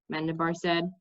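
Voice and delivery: plain reading voice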